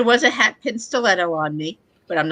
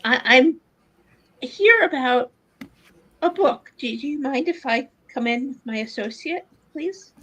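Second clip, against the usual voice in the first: a high, politely hesitant lady asking to come in; raised-pitch courteous visitor at the shop door; polite lady character; 6 semitones higher than usual. Acted. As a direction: play a genteel woman, pitched up and careful. Player voices her character.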